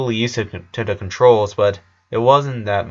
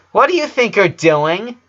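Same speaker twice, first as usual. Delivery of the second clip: put-on a high, indignant little girl; a voiced girl snapping at her sister; Lola, high girl voice; 9 semitones higher, 4 dB louder than usual. A narrator's reading. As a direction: demand it like a bossy young twin. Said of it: "asked her Lola".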